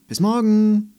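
high, loud call across the garden; child calling goodbye from the gate. A narrator's reading. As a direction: shout goodbye as she runs off.

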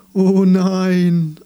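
high, loud, drawn-out wail; theatrical cry of dismay; dismayed chorus. A narrator's reading. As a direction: wail it like a chorus of alarmed trees. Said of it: "antworteten alle übrigen".

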